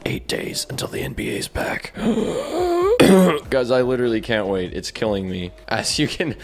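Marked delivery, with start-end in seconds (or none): 2.0-3.4 excited gasp. Real, not acted. Excited gasp at NBA news.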